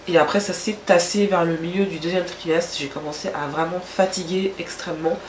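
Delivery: ordinary talk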